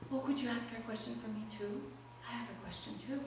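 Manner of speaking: soft, quick maiden's request; a hint of a sad maiden, softly voiced. A narrator's reading. Lonely maiden asks the traveler a favor, softly. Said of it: "She's like".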